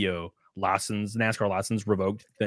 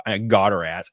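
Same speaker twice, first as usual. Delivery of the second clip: ordinary delivery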